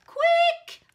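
very high, loud alarm call; urgent call. Acted. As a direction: yell it urgently. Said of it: "called the knight".

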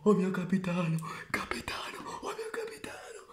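high whispered cries to the captain; ecstatic whisper. Acted. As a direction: breathe it out in awe.

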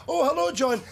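"Oh, hello, John" sung out high and cheery; mock breezy greeting. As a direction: greet John far too brightly, like nothing is wrong. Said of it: "Open the door and say".

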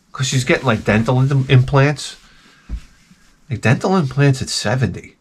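usual speaking voice

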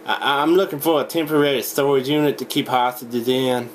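ordinary talk

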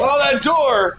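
loud high frustrated yell; frustrated shout. Real, not acted. Stuck on the door.

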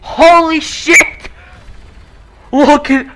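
can't believe what he's seeing; stunned excitement. Real, not acted. Gamer stunned by trailer footage, loud high amazement.

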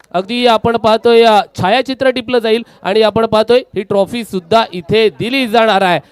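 ordinary talk

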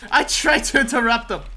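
high, explosive curse; frustrated shout. Real, not acted. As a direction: yell it out in sudden exasperation. Failed to interrupt opponent.

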